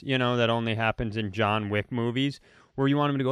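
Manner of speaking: normal talking voice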